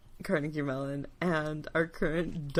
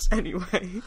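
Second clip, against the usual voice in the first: ordinary talk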